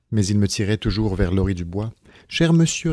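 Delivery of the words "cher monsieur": higher-pitched imploring address; mildly suppliant, pitch well up; pleading. A narrator's reading. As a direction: beg him to listen. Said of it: "répétai-je d'un air suppliant".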